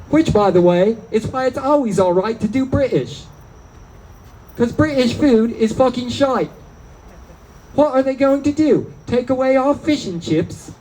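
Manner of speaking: hammed-up English accent. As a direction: put on a playful British accent. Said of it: "in an English accent".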